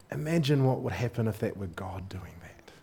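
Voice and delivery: hushed whisper to the congregation. Real, not acted. Preacher whispering a frightening thought. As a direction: lean in and breathe it.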